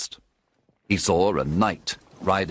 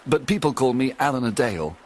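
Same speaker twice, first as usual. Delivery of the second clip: higher, lightly voiced sorrowful young man; unhappy Alan; 5 semitones higher than usual. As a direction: plead your story to Robin, sadly. Alan tells his sad tale.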